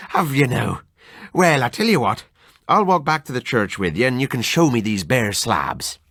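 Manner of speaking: lightly voiced Irish friend, laughing it off; mild Irish brogue mocking old wives' tales; hearty Irish doctor, amused. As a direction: scoff like a jolly Irishman. Narrator voices Kelly.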